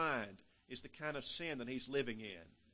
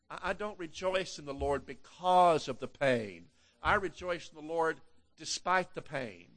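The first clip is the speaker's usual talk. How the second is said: preaching at full force; 9 dB louder than usual. Acted. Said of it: "preaching at full force".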